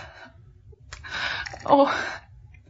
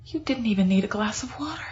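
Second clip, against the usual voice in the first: breathy hushed whisper; 2 semitones lower, 6 dB quieter, faster than usual. Acted. Woman whispering to herself in shock. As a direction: whisper it under her breath.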